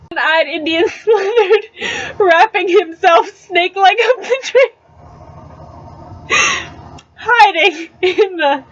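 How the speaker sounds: reading through real giggles; can barely keep a straight face, laughing between words; laughing while reading. Real, not acted. Reader laughs through the passage.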